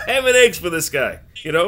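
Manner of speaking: amused laugh breaking into the line. Real, not acted. Laughing at Guy's meal.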